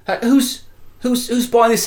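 high, stammered question; incredulous questioning. Acted. Crime boss quizzing his runner, incredulous. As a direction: sound baffled as you press for answers.

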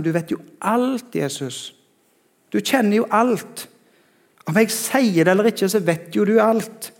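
imploring surrender, acted with restraint; capitulating. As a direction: give in, stretching out each admission. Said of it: "så kapitulerer Peter".

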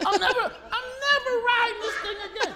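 high laughing vow, never again; real laughter while swearing off the ride. Real, not acted. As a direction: laugh it out in disbelief. Vowing off the roller coaster.

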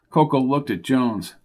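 ordinary delivery, nothing marked

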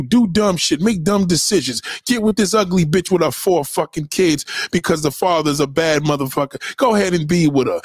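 ordinary delivery

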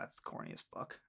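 whispering under the breath; embarrassed whispered mutter; whispered aside. Real, not acted. Embarrassed aside about corniness.

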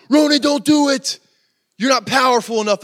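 loud, high mock-dramatic plea; acted shouted warning; urgent movie warning. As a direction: cry out to stop a villain, hammed up.

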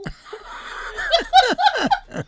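shrieking laugh; loud high laughter. Real, not acted. Nephew's mail answer.

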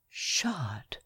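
a stunned, breathless whisper; shocked whisper. Acted. Lawyer whispering in shock at news of the murder. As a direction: barely breathe the word in disbelief. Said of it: "I could scarcely believe my ears".

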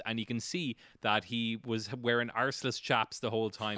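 ordinary delivery

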